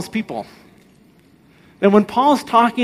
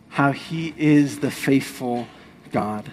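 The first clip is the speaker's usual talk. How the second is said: usual speaking voice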